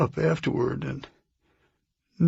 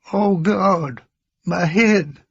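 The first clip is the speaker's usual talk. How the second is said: higher, louder cry of sudden agony; pained retold shout; 6 semitones higher, 5 dB louder, slower than usual. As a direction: cry out as if struck by head pain, retelling it. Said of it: "And suddenly he shouts".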